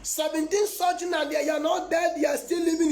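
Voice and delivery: high, shouted disbelief; amazed exclamation. Retold or imitated. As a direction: cry out in astonishment at the news. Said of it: "I said, "Oh my God".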